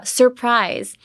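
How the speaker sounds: "surprise" said slowly, both R's clearly pronounced; careful R sound. Shown as a demonstration. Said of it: "the R sound is very hard".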